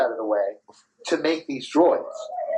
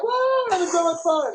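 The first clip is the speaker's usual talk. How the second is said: high-pitched mock excited whoop; gleeful crowd. Wristband riders cheering past those left out, mock gleeful. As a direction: imitate people having a great time, way up high.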